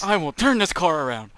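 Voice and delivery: stern parent threatening from front seat; refereeing kids in car. Pretend. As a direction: warn the kids like a fed-up dad.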